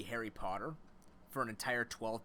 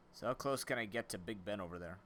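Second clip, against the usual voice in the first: ordinary delivery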